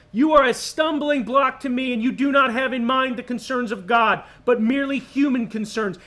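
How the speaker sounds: loud, sustained scolding; Jesus scolding Peter for human concerns; stern rebuke. Acted. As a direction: drive every phrase home hard.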